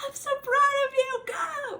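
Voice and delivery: high, loud cheering shout; proud cheering. Retold or imitated. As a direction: cheer them on like a proud mom. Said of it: "And I'm like".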